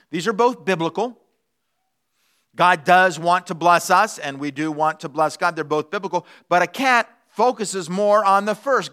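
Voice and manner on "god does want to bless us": ordinary delivery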